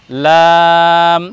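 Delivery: লাম held long and slow; teacher modelling the letter slowly; drawn-out letter name. Shown as a demonstration. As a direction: sing out লাম with a long vowel. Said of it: "আমরা উচ্চারণ করি".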